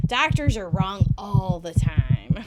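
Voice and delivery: normal talking voice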